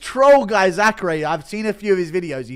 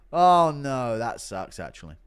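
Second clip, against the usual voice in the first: ordinary delivery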